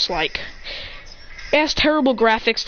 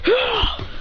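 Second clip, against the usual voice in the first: high short cry; battle cry; 13 semitones higher than usual. Acted. Kicking Hit in the face, high cry. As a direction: bark out a kick yell.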